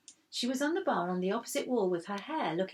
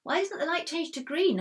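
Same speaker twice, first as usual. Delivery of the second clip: tearful; 10 semitones higher, 5 dB louder than usual. A narrator's reading. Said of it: "I asked Mum through my tears".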